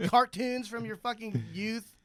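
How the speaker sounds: usual speaking voice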